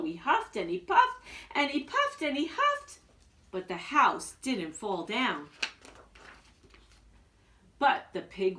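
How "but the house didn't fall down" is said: plain reading voice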